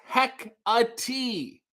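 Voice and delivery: Hecate said slowly as HEK-uh-tee; careful pronunciation. Shown as a demonstration. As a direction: sound out Hecate in three separate syllables, heck-uh-tee. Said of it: "my screen name is pronounced".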